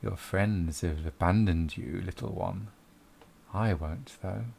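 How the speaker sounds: a low, slow voice from the darkness; dark whisper behind you. Acted. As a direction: tempt him softly from the shadows. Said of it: "you hear a voice in the darkness".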